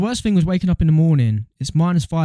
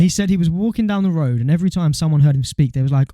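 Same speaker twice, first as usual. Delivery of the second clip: ordinary delivery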